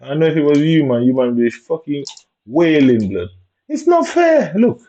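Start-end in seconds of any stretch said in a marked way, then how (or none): 3.7-4.8 high-pitched mock whine of protest.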